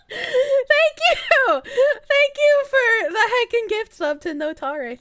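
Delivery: squeaky high-pitched thanks, slowed; exaggerated girly squeak of gratitude; cute high thank you. Thanking gift sub in cute voice.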